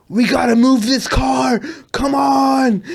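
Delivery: high-pitched mock exasperation; imitated pissed-off. European DJ urges others to move car, imitated anger. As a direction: act out an angry artist urging everyone on. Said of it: "He was fucking pissed".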